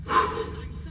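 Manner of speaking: long soft exhale; weary sigh. Real, not acted. Vlogger ending the comments segment, weary sigh. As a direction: sigh it out, worn down by the wind-up.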